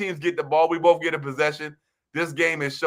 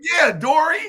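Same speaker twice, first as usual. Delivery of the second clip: excited call; 9 semitones higher than usual. Retold or imitated. Recalling how he cheered his friend, excited shout. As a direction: cheer him on like he just scored.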